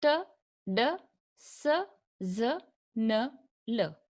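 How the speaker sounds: T, D, S, Z, N, L spaced out, each a separate short syllable; slow sound-by-sound demo. Shown as a demonstration. Teacher models alveolar sounds for learners, slow and separated. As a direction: say T, D, S, Z, N, L one by one with clear pauses. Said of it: "Listen it very carefully".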